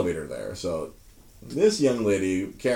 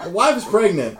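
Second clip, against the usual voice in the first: laughing; 11 semitones higher, 7 dB louder than usual. Acted. Repeated, laughing.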